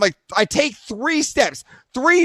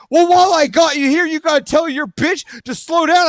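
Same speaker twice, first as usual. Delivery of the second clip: higher-pitched acted confrontation; mock-angry, raised voice; retold hostile neighbor; 6 semitones higher, 3 dB louder than usual. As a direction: imitate an aggrieved man starting an argument.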